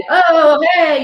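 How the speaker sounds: high, loud, overfriendly hello; mock breezy greeting. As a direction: act out what not to do, gushing a casual hello. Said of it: "Don't try to be like".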